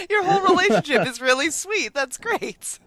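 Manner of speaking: high-pitched, laughing gush; delighted laughter. Real, not acted. Host delighted by guest's animal-rescue marriage, laughing and gushing. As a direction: gush it while still laughing, voice lifted.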